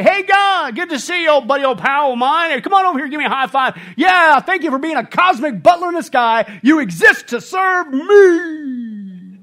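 high, loud mock-casual chumminess; mock a glib believer greeting God like a pal; flippant churchgoer. Preacher mocking a flippant church's chummy prayer. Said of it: "like the American church today".

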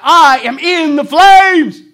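slow, high, loud anguished cry. Retold or imitated. Preacher quoting Hume, slow high anguished cry. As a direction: wail it out like a man burning. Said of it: "crying out".

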